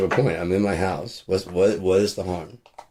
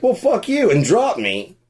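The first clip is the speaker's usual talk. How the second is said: mock dismissive bystander; 11 semitones higher, slower than usual. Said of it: "people just like".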